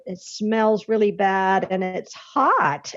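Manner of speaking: ordinary talk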